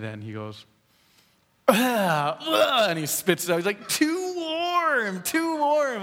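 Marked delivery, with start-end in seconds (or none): 1.7-2.9 high, loud "ah" of disgust; mock toddler spitting out food, loud and high; disgusted cry. Three-year-old rejecting his first bite, loud disgusted cry. 3.9-6.0 much higher mock toddler complaint; whining small child. Three-year-old refusing his sandwich to dad, high whiny protest.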